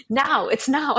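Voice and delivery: punchy mock urgency; retold urgency. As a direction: act out a sudden urgent call. Retold urgent cue before interviews.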